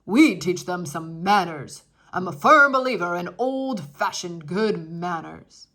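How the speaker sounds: loud stuffy guest; stern old man. Acted. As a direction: lecture the parents on manners. Stern Mister Yarby.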